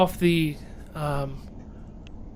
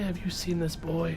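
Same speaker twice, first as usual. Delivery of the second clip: soft quoted line; 10 dB quieter than usual. Retold or imitated. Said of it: "What does he say?".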